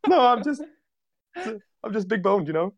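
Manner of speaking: high nervous mock denial; mock innocent. Disguised marine fibbing to captor, high and hammed up. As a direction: squeak out a lame excuse. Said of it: "disguised marine denies".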